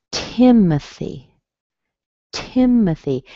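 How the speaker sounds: "Timothy" as TIM-uh-thee, TIM drawn out; strong first syllable. Shown as a demonstration. Teacher naming her brother, first-syllable stress on "Timothy". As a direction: stretch the start of "Timothy", reduce the O. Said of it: "Strong in the beginning".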